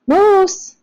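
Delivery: loud, high call of the name. A narrator's reading. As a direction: boom the name from the doorway. Dad calls him out.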